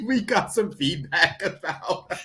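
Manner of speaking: laughing. Real, not acted. Laughing while talking.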